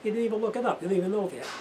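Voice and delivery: high, loud mock-indignant ribbing; joking disbelief at the parts guy on the phone; mock outrage. Real, not acted. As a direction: needle him with playful outrage.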